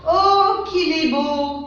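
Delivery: high "Oh" then slow admiring line; admiring exclamation. Shown as a demonstration. Teacher models admiration for the class, high and slow. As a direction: gasp in wonder at beauty. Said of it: "ce sont d'exclamations".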